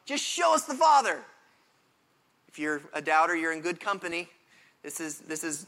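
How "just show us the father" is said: high, loud exasperated plea; whiny demand. Retold or imitated. Philip pestering Jesus, a high, loud whiny demand. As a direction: make him sound impatient. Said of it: "And he's going".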